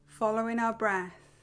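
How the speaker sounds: soft, unhurried meditation voice with long pauses; guided meditation. Real, not acted. Teacher guiding listeners to follow their breath, guided meditation. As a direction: gently lull them into stillness, letting phrases settle.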